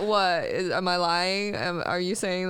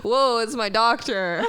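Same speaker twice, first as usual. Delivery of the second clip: slow, louder, higher-pitched protest; mock outrage; 4 semitones higher, 5 dB louder than usual. Patient objecting to talk about their doctor, mock outrage. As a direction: play offended on your doctor's behalf.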